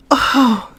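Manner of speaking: ordinary talk, nothing marked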